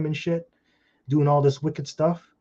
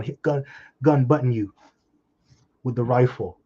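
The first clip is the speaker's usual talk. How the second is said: usual speaking voice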